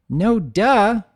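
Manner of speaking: high, loud sassy filly voice; cheeky filly. Acted. Filly sassing the dragon, high and loud. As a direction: toss it out like a cheeky little girl. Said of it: "Firefly responds".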